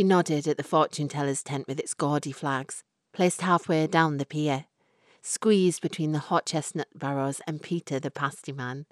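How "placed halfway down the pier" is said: ordinary delivery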